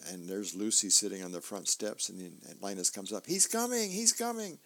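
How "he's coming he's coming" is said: put-on high and eager, a boy bursting with news; much higher, announce it like a thrilled little kid; excited kid voice. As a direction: Linus squealing that someone is coming. Linus excitedly announcing someone.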